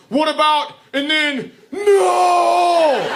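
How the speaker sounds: high, loud broken starts into a huge no; embarrassed yell. Retold or imitated. Embarrassed son answers dad through the door, yelling. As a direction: abandon two sentences and explode into a long shout. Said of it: "That's what he yelled".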